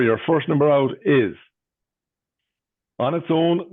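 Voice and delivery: sing-song bingo number calling; bingo caller. Real, not acted. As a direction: lift the first half, drop the second.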